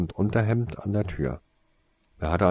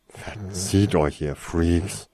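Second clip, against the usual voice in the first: gruff dismissal; much slower than usual. A narrator's reading. Grumpy man dismisses the children.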